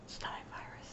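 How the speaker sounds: hushed whisper, almost no voice. Real, not acted. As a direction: breathe the words out as an aside. Whispered aside about being sick.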